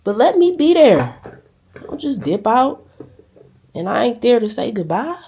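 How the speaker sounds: loud, pleading, slowed on let me be there; passionate plea. Retold or imitated. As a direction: beg him to wait, voice rising. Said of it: "So I told him".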